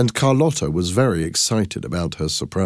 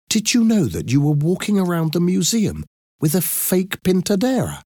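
much higher, incredulous questioning voice; disbelieving sister; 10 semitones higher than usual. Acted. Sister to sister about returning to the museum, incredulous. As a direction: question her sanity in a high voice.

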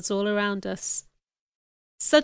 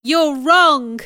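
high, loud contradiction; forceful raised voice; shouting; 5 semitones higher, 8 dB louder than usual. A narrator's reading. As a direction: shout the rebuke. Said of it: "I shouted".

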